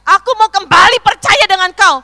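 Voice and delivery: loud, high cries of surrender to God; impassioned high-pitched yelling to God; retold shout. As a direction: re-enact a fervent prayer at full voice. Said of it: "saya teriak".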